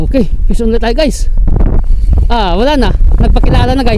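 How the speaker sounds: high, loud excited calling; excited shout. Real, not acted. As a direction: shout out the new bite. Another bite, then lost.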